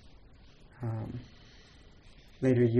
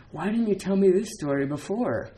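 delighted exclaim; 8 semitones higher than usual. Retold or imitated. Voicing delighted Yao Shan again.

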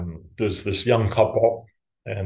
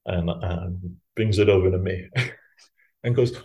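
ordinary talk

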